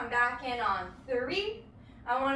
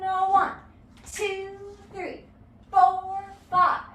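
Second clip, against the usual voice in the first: rhythmic teacher count, four punched loud; dance count-in; 3 semitones higher, 3 dB louder, slower than usual. Shown as a demonstration. Dance teacher counting the class in, loud on four. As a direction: count the class in with a driving beat. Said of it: "a little bit of a rhythmic emphasis".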